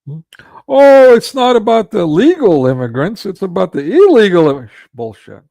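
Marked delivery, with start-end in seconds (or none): 0.7-4.7 mock the excuse, much higher and louder; shrill parody of a politician's line, pitched way up and loud; sarcastic high loud mimicry of an excuse; mocking high whine. Mocking quote, then "bullshit.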